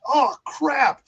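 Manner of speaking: retold dismay, pitched well up; imitated panic. Wife seeing the overloaded pomegranate tree, mock panic. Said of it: "got a panic look on her face".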